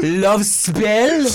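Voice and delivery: name it like a mock Frenchman; French accent. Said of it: "we are talking in French".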